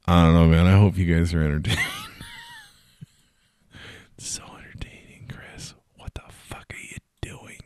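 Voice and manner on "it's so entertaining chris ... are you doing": whispered aside to himself. Real, not acted. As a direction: hiss it as a self-mocking aside. Mocking himself to himself.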